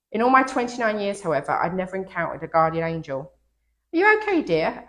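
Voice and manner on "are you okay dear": lightly voiced kind older lady, pitched much higher; a hint of a concerned lady on a train platform; lady's voice. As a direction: ask gently, as a caring stranger. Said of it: "a lady asked".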